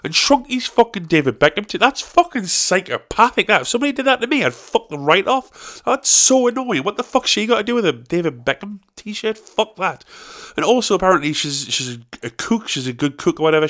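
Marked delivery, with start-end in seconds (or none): 0.7-7.9 high, indignant rant; outraged. Real, not acted. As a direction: rant at it in disbelief. Outraged rant about shirt.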